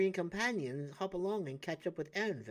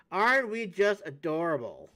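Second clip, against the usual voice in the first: ordinary talk, nothing marked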